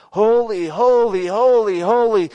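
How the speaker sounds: loud, high, ringing acclamation; angels proclaiming. Acted. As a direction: call out each word in the same rising-falling swell. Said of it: "they're flying around saying".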